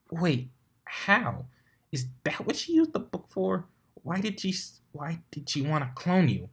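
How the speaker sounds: high, rushed unicorn voice firing questions; a bookish mare, rattled and demanding answers; flustered Twilight. Acted.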